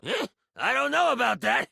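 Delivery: high-pitched doubtful character voice; protesting trowel. Acted. Trowel protests being handed to the hero, doubtful and high. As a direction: balk in a comic high voice. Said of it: "Trowel protests".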